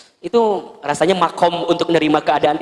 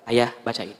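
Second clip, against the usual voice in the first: soft hurt request; 7 semitones lower, 8 dB quieter than usual. Acted. Wife hands husband the picture.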